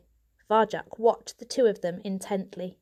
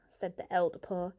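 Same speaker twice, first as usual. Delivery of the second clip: usual speaking voice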